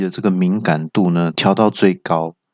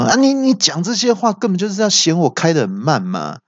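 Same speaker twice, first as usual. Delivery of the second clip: angry complaint; 9 semitones higher than usual. Retold or imitated. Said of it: "我就很生气".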